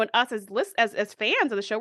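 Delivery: ordinary delivery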